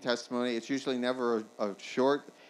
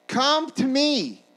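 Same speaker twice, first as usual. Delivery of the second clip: high, slow, full-voiced call; shouting; 13 semitones higher, 11 dB louder, much slower than usual. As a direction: holler the invitation, long and high. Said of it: "he's shouting".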